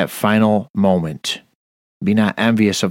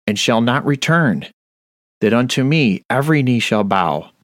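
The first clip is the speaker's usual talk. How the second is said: ordinary talk, nothing marked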